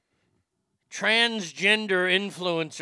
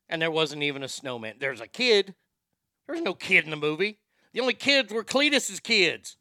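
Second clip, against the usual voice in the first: ordinary delivery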